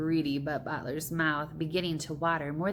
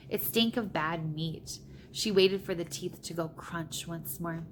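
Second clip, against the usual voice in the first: usual speaking voice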